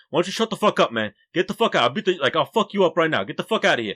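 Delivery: bad impression of Hector. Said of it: "That was a horrible impersonation".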